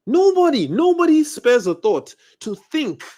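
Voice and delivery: very high, loud, slowed "nobody"; exasperated outcry. Real, not acted. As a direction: stretch out the indignation.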